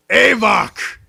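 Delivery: high, loud ringing call of the name. Acted. Voice behind the crowd calling to the party, loud and high. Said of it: "you hear very, very clearly".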